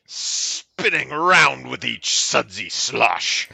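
slow, savoured poetry reading; mock-earnest, lingering verse delivery; poem recital. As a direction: draw out each image as you recite. Reads a poem aloud.

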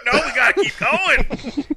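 slow, loud laughing insistence; eager shout. Retold or imitated. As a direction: push on, laughing and excited. Acted eager man, laughing.

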